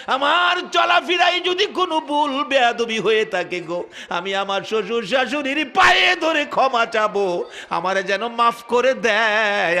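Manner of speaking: high, loud wailing plea of a woman in labour; desperate calling out. Acted. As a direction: call out and beg, pitched high, easing toward the end. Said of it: "মুরব্বী মহিলাদেরকে ডাকে".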